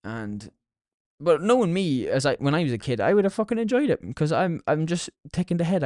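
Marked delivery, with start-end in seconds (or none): none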